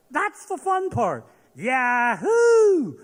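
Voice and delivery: high, loud, gleeful yahoo on the drop; thrilled whoop. Acted. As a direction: whoop like riding the coaster.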